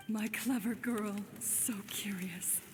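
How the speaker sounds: put-on low, tender goodbye to a child; tender farewell. Mother bidding her daughter farewell, tender and low. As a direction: soothe her as you say goodbye.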